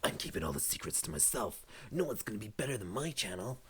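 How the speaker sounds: mock a possessive channel owner; secretive YouTuber. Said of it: "one of these guys who'd be like".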